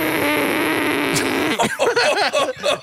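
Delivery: high, drawn-out cartoon grumble; long put-on disapproving hum; Marge Simpson. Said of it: "That's my Marge".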